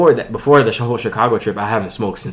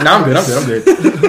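higher, louder, laughing insistence he's fine; laughing mock denial; 6 semitones higher, 8 dB louder than usual. High guy on a plane convincing himself, laughing denial. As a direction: brush it off through laughter, protesting too much.

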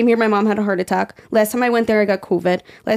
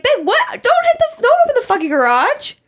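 shrill high disbelief, much higher; incredulous yell. Retold or imitated. Picturing scolding her husband for opening the garage, a very high incredulous shriek. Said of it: "I'd be like".

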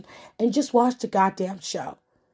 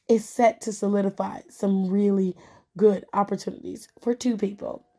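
usual speaking voice

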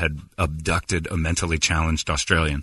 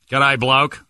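higher-pitched Aussie greeting; put-on Australian accent; fake Australian accent; 6 semitones higher, 3 dB louder than usual. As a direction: toss off a faux Australian hello. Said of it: "talk to the waiter as an Australian".

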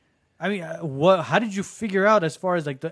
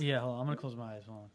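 quiet; 8 semitones lower, 13 dB quieter, much faster than usual. Real, not acted. Closing eyes for energy reading.

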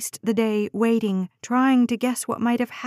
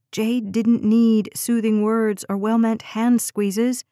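ordinary delivery

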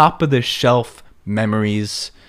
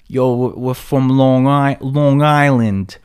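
exaggerated Long Island accent, dragged out; broad, drawling Long Island accent; thick Long Island accent; 4 semitones higher, 4 dB louder, slower than usual. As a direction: imitate Long Island relatives, loud and slow. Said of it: "Sounds even worse. They say, like".

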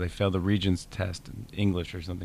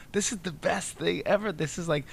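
high, gushing and getting quieter; a kid thrilled at comedy camp; softer high giddy gush; giddy kid's delight; 9 semitones higher, 5 dB quieter than usual. Retold or imitated. Quoting his younger delighted self.

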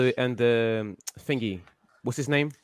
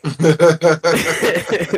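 loud burst of laughter; 4 semitones higher, 8 dB louder than usual. Real, not acted. Reacts to friend's joke.

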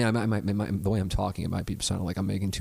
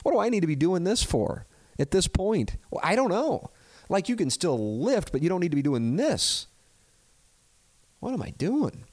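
exasperated self-mockery; 11 semitones higher than usual. Answering his physical therapist, high exasperated self-mockery. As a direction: throw the question back at yourself. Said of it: "yeah, I go".

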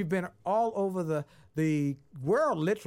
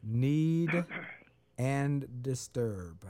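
very slow, lower repeat; 4 semitones lower, much slower than usual. Shown as a demonstration. Host repeating dictated words back slowly.

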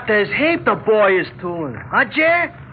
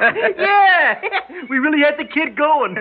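higher, laughing agreement; 8 semitones higher than usual. Real, not acted. [name] agreeing with Jerry, laughing through it.

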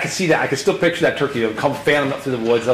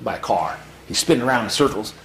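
ordinary delivery

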